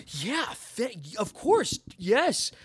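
high, eager yes, stumbling over words; thrilled to be noticed, voice leaping up; excited athlete. Retold or imitated. Unknown player thrilled at a fan's photo request, excited. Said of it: "he was very excited".

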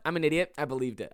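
usual speaking voice

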